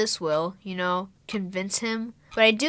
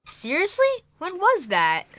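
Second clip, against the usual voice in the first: higher, eager disbelief; amazed; 8 semitones higher than usual. Acted. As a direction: leap up in pitch, hungry to know more. Boy amazed at the news.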